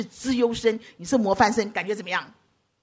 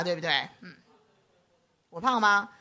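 ordinary talk, nothing marked

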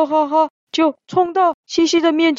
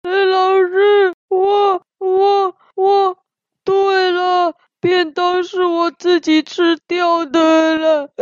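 higher, tearful voice, slow and broken by sobs; crying while talking; 5 semitones higher, slower than usual. Acted. Xixi confessing to the teacher while crying, tearful and broken. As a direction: confess through tears, stumbling on each 我. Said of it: "西西边哭边说".

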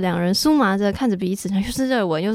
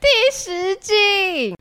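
announcer voice; 10 semitones higher, much slower than usual. Acted. Show host announcing episode.

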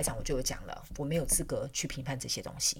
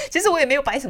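笑着说; 11 semitones higher, 11 dB louder, faster than usual. Real, not acted. 笑后接着说.

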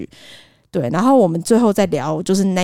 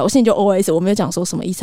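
ordinary talk

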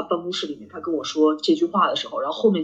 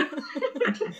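real high laugh; 11 semitones higher, 9 dB quieter than usual. Real, not acted. Laughs at friend's tease.

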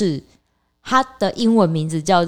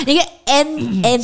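Taiwanese accent; 3 semitones higher, slower than usual. Shown as a demonstration. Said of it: "请用台湾国语方式".

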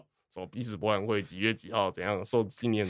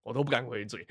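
normal talking voice

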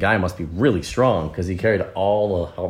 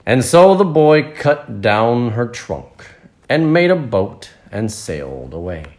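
slow, stretched storybook reading; story narration; 4 semitones higher, 8 dB louder, much slower than usual. A narrator's reading. Narrator tells children of the happy tree, slow storytelling voice. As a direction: draw out the phrases for listening children.

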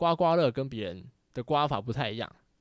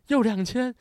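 high, loud, stunned question; can't believe the win; shocked disbelief; 10 semitones higher, 7 dB louder, slower than usual. Retold or imitated. Seeing another two-thousand win, high stunned disbelief.

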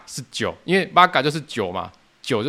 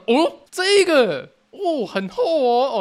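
much higher, slow, drawn-out oh of delight; impressed wow; 12 semitones higher, 3 dB louder, much slower than usual. Retold or imitated. Customer tasting the strong cocktail, slow delighted higher wow. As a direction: savor it like a rare find.

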